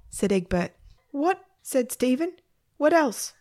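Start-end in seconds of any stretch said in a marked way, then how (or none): 1.1-3.3 high, startled little question; boy taken aback, pitched up; incredulous boy. A narrator's reading. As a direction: squeak it in disbelief.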